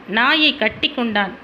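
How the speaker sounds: ordinary delivery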